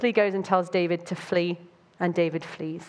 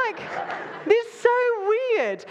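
amused disbelief; 13 semitones higher than usual. Real, not acted. Reacting to own bit.

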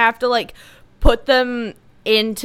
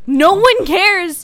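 loud slow dismissive; 8 semitones higher, 4 dB louder, much slower than usual. Acted.

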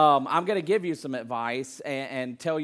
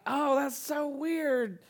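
high, slow, mock-innocent surprise; fake surprise; 11 semitones higher, slower than usual. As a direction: play innocent, slowly. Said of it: "You're like".

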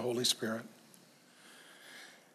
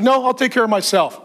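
high, loud insistent refusal; imitating a proud refusal; 9 semitones higher, 8 dB louder than usual. Preacher voices the proud man turning down help, insistent. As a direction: push the help away, pitched up hard. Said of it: "He's like".

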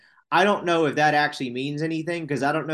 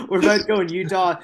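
laughing; 5 semitones higher, 6 dB louder than usual. Real, not acted. Laughing at his own slip.

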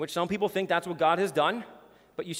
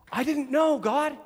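pleading ignorance; 8 semitones higher, 3 dB louder than usual. Retold or imitated. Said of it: "They're gonna plead ignorance".

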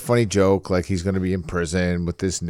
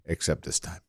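breathy conspiratorial whisper; voiceless whisper, quick and sly; whispered aside; 4 semitones lower, 12 dB quieter than usual. Acted. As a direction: lean in and whisper it like a secret. Playful aside, then repeated aloud.

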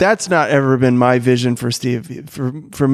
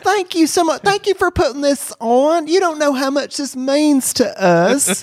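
high, sweet, gushing church lady; female children's pastor voice; 12 semitones higher than usual. Acted. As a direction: gush thanks like a sugary children's pastor.

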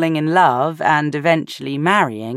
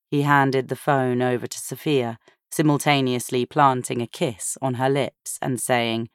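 usual speaking voice

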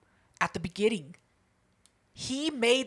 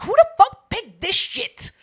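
mock outraged yell; 10 semitones higher, 8 dB louder, slower than usual. Retelling her outrage at movie pick.

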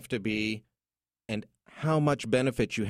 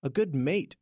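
put-on light Aussie accent, pitched higher; mock Aussie accent; 8 semitones higher than usual. Host echoing his Australian guest's slang, light Aussie accent. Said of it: "as he would say".

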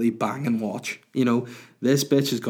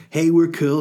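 mock cool; 6 semitones higher, 7 dB louder than usual. Voicing trendy shoes mockingly.

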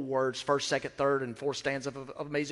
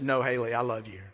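tender reply; 3 semitones lower than usual. Retold or imitated. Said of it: "And I said".

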